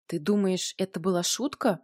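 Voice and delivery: ordinary delivery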